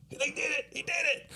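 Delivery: high, soft giddy cheer; excited cheering. Retold or imitated. Prankster cheering as Murr pulls it off, high and giddy. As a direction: squeal it like a delighted prankster.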